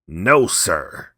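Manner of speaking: loud, defiant refusal; cried out. Acted. Drunk old man refuses Kiave, loud and defiant. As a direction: bellow a flat refusal. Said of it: "cried the old man".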